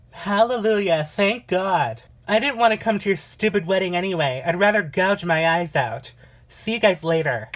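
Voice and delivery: high, loud mocking triumph; sarcastic loud exit. Uninvited sister mocking the wedding as she leaves, loudly. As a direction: shout it sarcastically on the way out.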